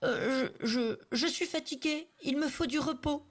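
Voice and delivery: high, stammering feigned weakness; hesitant voice. Acted. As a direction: stumble over the words like a tired peasant. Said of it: "d'une voix volontairement hésitante".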